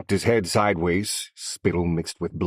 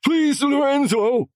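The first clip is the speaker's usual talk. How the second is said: begged; 7 dB louder, slower than usual. A narrator's reading. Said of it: "he begged".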